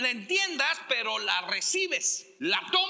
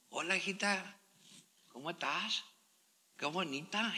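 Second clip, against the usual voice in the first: sweet greeting; 6 semitones lower, 9 dB quieter than usual. Pretend. Husband sweetly greets wife.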